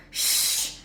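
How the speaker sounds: sharp unvoiced hush; loud shush. A narrator's reading. Hagrid hushing Ron in the library. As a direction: silence them quickly.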